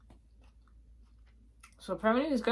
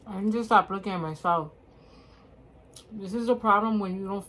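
talking while chewing; chewing food; 6 dB louder than usual. Real, not acted. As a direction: mouth partly full. Seen 2: Chopsticks in hand, lips closed and working between words, food taken before.

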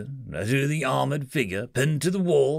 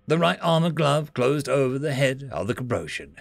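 ordinary delivery

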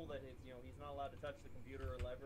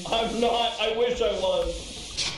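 loud, higher calling out; shouting; 6 semitones higher, 18 dB louder, slower than usual. Real, not acted. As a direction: yell it over the noise. Yelling into game chat.

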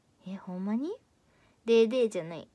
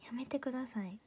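bashful breathy whisper; 10 dB quieter than usual. Real, not acted. Streamer embarrassed by a viewer's odd comment, whispering.

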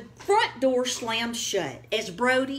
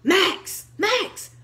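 higher and louder, urgent yell; 12 semitones higher, 5 dB louder than usual. Acted. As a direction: yell down into the pot. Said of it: "yelled Dakota".

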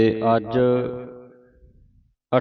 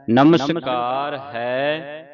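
slow, drawn-out measured chant of the line; scripture recitation; 3 semitones higher, much slower than usual. Shown as a demonstration. Teacher reciting a verse for students to follow, slow measured chant. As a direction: recite it slowly, holding the long vowels.

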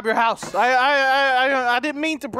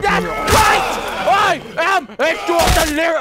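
loud, high fierce yells; triumphant shouting; 7 semitones higher, 6 dB louder than usual. Real, not acted. Player beating the attacker down, shouting in triumph. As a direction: roar it as you win the fight.

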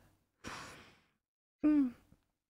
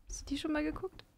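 usual speaking voice

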